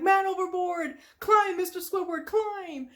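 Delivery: high, urgent mock shout; Mister Krabs. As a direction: call out as if someone's drowning. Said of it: "Mister Krabs is just hanging on".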